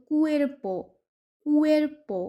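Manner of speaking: কুয়ের্পো with a hard ক, carefully modelled; Spanish word cuerpo. Shown as a demonstration. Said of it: "সে এর পরে উ থাকলেও একই নিয়ম".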